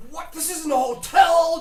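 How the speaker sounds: put-on outraged drunk yelling at a cold stranger; drunk man flipping out. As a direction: blast him like an angry drunk who's had enough. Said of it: "the guy with the whiskey flipped out".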